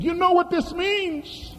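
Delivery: high, soft, slow woman's warning; hushed ominous question. Retold or imitated. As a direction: voice the trembling woman's dread. Hungarian woman voiced in story.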